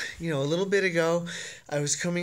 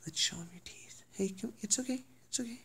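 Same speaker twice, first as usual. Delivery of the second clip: much softer whispered reassurance; calm the scared dog; soothing whisper; 3 semitones higher, 11 dB quieter, faster than usual. Real, not acted.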